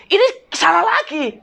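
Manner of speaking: high-pitched mock complaint; imitating complaining customer. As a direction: voice the annoyed buyer. Customer calls to complain again.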